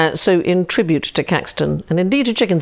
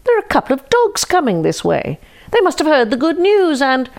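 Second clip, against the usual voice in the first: innocent chicken; 9 semitones higher than usual. Acted. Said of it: "said the chicken".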